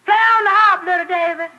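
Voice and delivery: shrill high scream from an adoring fan; gillend gefan. Acted. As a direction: shout it out in a piercing, high girlfriend voice. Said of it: "They'd scream things like".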